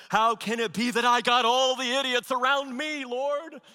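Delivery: high, loud mock indignation; judgy inner rant. Preacher retells his annoyed inner prayer, mock indignant and loud. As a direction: rant at God, half in jest. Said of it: "I didn't say that out loud, but I'm thinking it".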